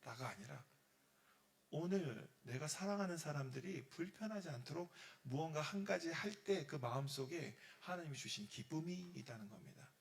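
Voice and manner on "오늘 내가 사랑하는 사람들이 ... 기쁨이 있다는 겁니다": ordinary talk, nothing marked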